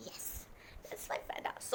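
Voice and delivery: soft, whispered coo over a toy. Real, not acted. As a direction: murmur lovingly to a stuffed panda. Showing off her soft toy.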